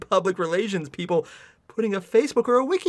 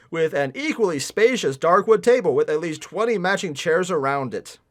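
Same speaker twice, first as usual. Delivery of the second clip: story narration; 4 semitones lower than usual. Acted. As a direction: read the passage out dryly. Reads the fanfic aloud.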